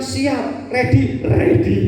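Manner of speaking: playful ready. Pretend. Playful Indonesian-style answer.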